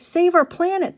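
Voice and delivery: high, punchy crowd shout; shouted back. A narrator's reading. Campaigners answer George's dad, high shout. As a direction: throw the slogan back at the speaker. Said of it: "the campaigners shouted back at him".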